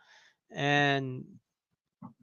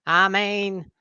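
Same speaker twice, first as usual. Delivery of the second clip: mock Southern; 5 semitones higher, 4 dB louder than usual. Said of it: "We would just send each other".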